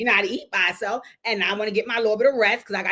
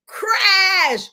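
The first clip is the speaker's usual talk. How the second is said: loud, high imitation of a crash; mock crash; 9 semitones higher, 6 dB louder than usual. Acting out the bowl hitting the floor, loud. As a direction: smash the word like a falling bowl.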